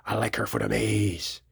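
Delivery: low, soft, slow movie-villain voice; imitating the guy. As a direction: drop the voice low and drag it out like the character. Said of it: "he goes".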